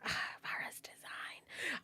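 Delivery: breathy whisper of admiration, slowly; awed whisper. Real, not acted. Streamer admiring the next VTuber's design, whispered. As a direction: whisper it like you're in awe.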